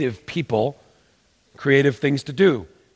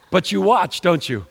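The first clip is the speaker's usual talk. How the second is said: normal talking voice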